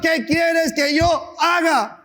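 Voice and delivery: slow, high shouted plea of surrender; Paul's cry. Acted. Paul crying out to the Lord, shouted plea.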